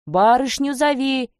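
slow, high-pitched commanding call; threatening shout. A narrator's reading. Tatar threatening with a whip, demanding shout. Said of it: "Кричит, плёткой грозит".

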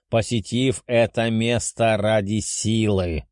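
slow, imposing beast voice; stern dragon. Acted. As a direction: decree it like an ancient dragon. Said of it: "заявил дракон".

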